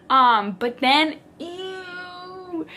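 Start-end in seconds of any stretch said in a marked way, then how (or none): 1.4-2.7 long, soft, high-pitched "ew"; drawn-out squirm of disgust. Real, not acted. Recalling what icked her out, disgusted. Cringing at the story she tells.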